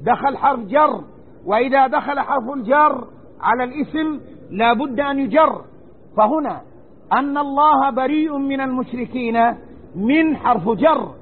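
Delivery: ordinary talk, nothing marked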